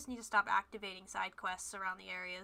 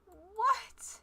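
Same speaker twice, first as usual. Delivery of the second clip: high, loud disbelieving yells; exasperated shout; 8 semitones higher, 10 dB louder, much slower than usual. Real, not acted. Streamer yelling at the game over a locked quest, exasperated. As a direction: bark it at the screen.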